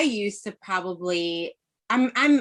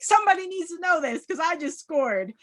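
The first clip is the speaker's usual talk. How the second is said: excited; 11 semitones higher than usual. Retold or imitated. Excited quote of scoring.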